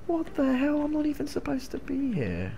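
high, soft squeaky put-on voice; tiny bewildered cartoon voice; squeaky meme voice. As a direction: pipe it up small and baffled.

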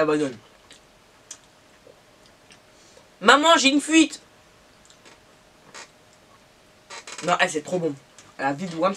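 eating sandwich between words; talking while chewing. Real, not acted. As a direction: mouth partly full of food. Seen 0: bites just before; holds sandwich, chewing, licks fingers while speaking.

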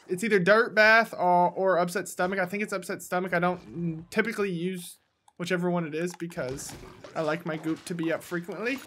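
normal talking voice

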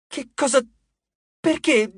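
high, startled panic; panicked squeak. Acted. Frank sees Nico turned into a plant, squeaking in panic. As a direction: voice jumps up in shock.

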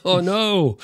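laughing "oh no" high up; a laughing, mock-horrified cry; amused shocked protest. Real, not acted. Laughing reaction to garbage verdict.

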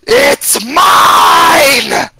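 high, full-volume shout; furious scream. Acted. Stocky bald man screaming in the adventurer's face, furious high shout. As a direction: scream it with everything, stretched out. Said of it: "he screams in your face".

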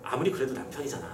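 low, soft musing aside; muttering to oneself. Acted. Wife pitying her flogged husband, murmuring to herself. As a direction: murmur it privately, relenting. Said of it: "혼잣말로".

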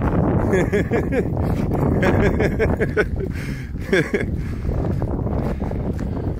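loud, high laughing fit; long laugh. Real, not acted. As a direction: keep laughing hard and long. Laughing at the dogs.

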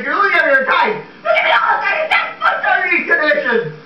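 very high, near crying shout; rising panic. Acted. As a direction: crack under the pressure. Bloom panics under time pressure.

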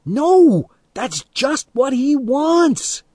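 high, urgent drawn-out yell; shouted. A narrator's reading. Friend restrains Teddy by his ear, shouting. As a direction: yell it to get through to him. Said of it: "I shouted in his ear".

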